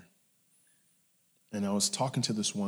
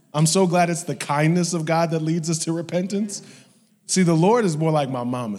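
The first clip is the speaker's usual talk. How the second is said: loud, raised-pitch sermon delivery; fervent, projected declaration; impassioned preaching; 7 semitones higher, 10 dB louder than usual. Real, not acted. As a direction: preach it out to the whole congregation. Preaches at full force.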